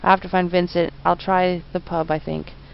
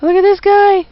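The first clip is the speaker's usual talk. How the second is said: much higher, louder gleeful yelling; excited shouting; 12 semitones higher, 9 dB louder than usual. Real, not acted. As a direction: shout with delight at the silly face. Laughing at funny game character.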